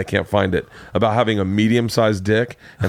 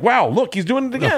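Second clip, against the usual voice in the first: mocking friends; 13 semitones higher than usual. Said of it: "they're like".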